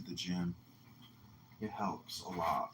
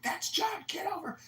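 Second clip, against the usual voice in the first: very high cartoon squeak; mocking imitation; 4 dB louder than usual. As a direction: squeak the name like a toy. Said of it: "everybody all the time now is saying".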